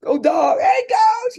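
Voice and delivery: loud, high call-out; shouted, drawn-out sports cheer; retold yell. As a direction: holler it like greeting a fellow fan passing by.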